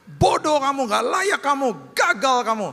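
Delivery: high, loud, sneering accuser; devil's accusing voice. Retold or imitated. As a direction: hurl insults like the devil tearing someone down. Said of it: "Itu iblis".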